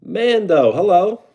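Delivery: high, loud hello to a viewer; excited greeting. Real, not acted. Streamer loudly greets viewer Mando, excited. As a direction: call out a cheerful hello.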